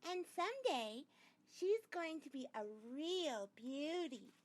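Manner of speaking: high, slow gushing mother voice; admiring mother. A narrator's reading. Mother praises Sheila to Peter, high and gushing. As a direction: gush over the neighbour girl. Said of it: "my mother says".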